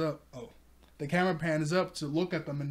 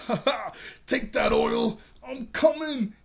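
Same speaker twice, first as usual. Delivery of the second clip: loud, higher battle cry; triumphant yell; 8 semitones higher, 6 dB louder than usual. Retold or imitated. Zoro charging up the oily hill, loud triumphant yell. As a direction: crow in triumph as you charge.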